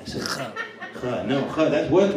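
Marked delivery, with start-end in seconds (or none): 0.0-0.5 whispering Kha into the mic. Real, not acted. Whispering the name to a heckler who asked for it. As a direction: breathe Kha as a secret. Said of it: "Oh, you gotta whisper it?".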